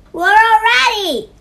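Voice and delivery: high, loud call down from a cliff; big storybook shout. A narrator's reading. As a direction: holler it to friends far below. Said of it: "he shouted".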